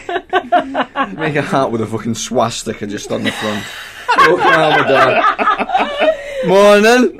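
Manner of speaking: laughing through the words; cracking up while talking. Real, not acted. Joking, laughing hard.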